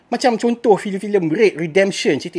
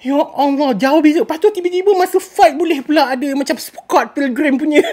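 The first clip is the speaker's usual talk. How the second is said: ordinary delivery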